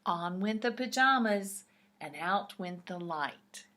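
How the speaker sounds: plain reading voice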